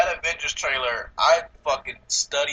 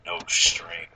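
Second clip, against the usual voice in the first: lightly imitated menacing robot, low pitch; a low-voiced hint of a movie villain; Ultron; 8 semitones lower than usual. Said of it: "and he's just like".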